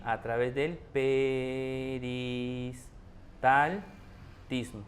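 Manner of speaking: very slow, every syllable stretched; teacher dragging out "peristaltismo" for emphasis; drawn-out emphasis. Shown as a demonstration. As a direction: spell out "A través del peristaltismo" slowly for students to copy. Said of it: "teacher stretches the key phrase".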